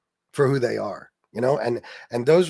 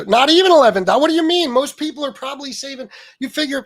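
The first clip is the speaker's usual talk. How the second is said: much higher and louder, fired-up disbelief; exasperated outburst; 9 semitones higher, 7 dB louder, faster than usual. Real, not acted. Ranting at Trump supporters about gas savings, exasperated outburst. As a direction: blurt it out in outrage.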